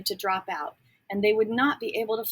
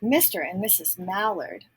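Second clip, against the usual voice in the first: ordinary delivery, nothing marked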